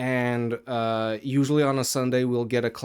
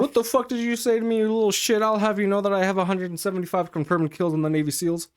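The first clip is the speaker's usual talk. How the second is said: high, rushed indignant rant; fast, hammed-up outrage; mock tough-guy threat; 7 semitones higher, faster than usual. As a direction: rattle it off as an over-the-top boast.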